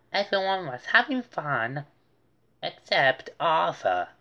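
ordinary delivery, nothing marked

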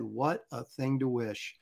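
slow, low and soft solemn reply; grave stage line. Retold or imitated. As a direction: deliver it like a grave dad in a play. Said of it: "And then I said".

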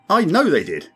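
high and loud, grimly certain. A narrator's reading. Crewman grimly warning his captain, loud. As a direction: state it like a verdict. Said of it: "looking grim".

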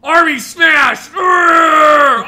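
loud, high, slow monster bellow; smash-everything roar with a growled grunt; roaring brute. Pretend. Hulk-like parody of army motto.

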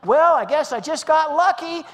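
high, loud, sing-out delivery; hammed-up cheerful reply; breezy boast. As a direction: play up crediting your own luck. Acted breezy reply, credit taken.

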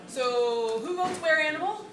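rally the room to vote; calling out to the crowd. Real, not acted. Polling the audience for a vote.

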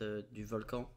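normal talking voice

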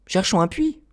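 put-on high, light child voice; a small boy, decided; little prince's voice. Prince proposes finding a well.

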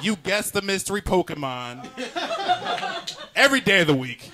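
high, loud indignant complaint; exasperated kid outburst. Acted. Kid scolding dad who watches every day, high and building to loud. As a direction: build to a loud childish protest.

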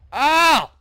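high, loud "ow"; pained yelp. Real, not acted. Player hit in the game, loud yelp.

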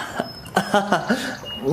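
bright embarrassed little laugh, falling away; shy laugh. Acted. Young woman laughing shyly after his blunt confession. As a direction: laugh off the awkward moment.

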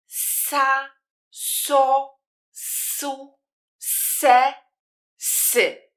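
"sa, so, su, se, sy" carefully, with a hissed "s"; syllables said again. Shown as a demonstration. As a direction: repeat "sa, so, su, se, sy" like the demo, slowly at first. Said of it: "które wcześniej wymówiłam". (ponownie wymówione „sa, so, su, se, sy” z syczącym „s”)

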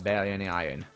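ordinary talk

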